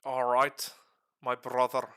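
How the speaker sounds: hammed-up Irish accent. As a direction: belt "my brother" with an Irish lilt. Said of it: "Wait, that's cheating".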